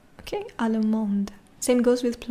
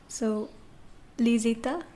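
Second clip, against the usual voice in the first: normal talking voice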